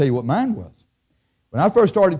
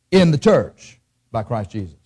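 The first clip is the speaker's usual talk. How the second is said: normal talking voice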